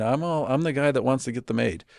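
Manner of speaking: ordinary talk, nothing marked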